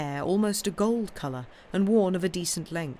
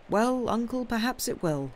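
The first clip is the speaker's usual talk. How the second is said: higher-pitched, tentative assent; dutiful agreement; 7 semitones higher than usual. Acted. Nephew giving in to his uncle, dutiful. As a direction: concede politely.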